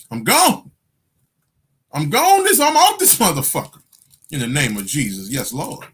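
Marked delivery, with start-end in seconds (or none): 0.1-3.8 high-pitched declaration of leaving; comic high-voiced farewell; mock exit announcement. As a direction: announce your getaway way up high. Acts out announcing his exit.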